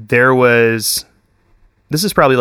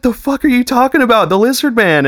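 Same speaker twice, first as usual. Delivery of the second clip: frantic disbelief; 12 semitones higher than usual. Retold or imitated. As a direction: squeak it out, totally freaking out. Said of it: "I'm like".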